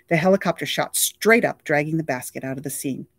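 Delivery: plain reading voice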